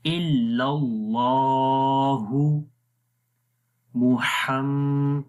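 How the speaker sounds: इल्लल्लाहु slow, with the Allah lam said heavy; heavy lam recitation. Shown as a demonstration. Teacher models the heavy lam of Allah, slow recitation. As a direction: recite इल्लल्लाहु with a thick lam and a held vowel. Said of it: "मोटा पढ़ना है".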